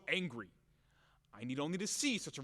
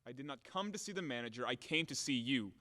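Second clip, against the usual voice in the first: ordinary talk, nothing marked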